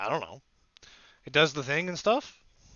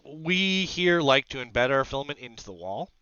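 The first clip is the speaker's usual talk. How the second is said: ordinary talk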